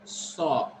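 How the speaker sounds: "saw" with a held aw; long vowel. Shown as a demonstration. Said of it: "is long A".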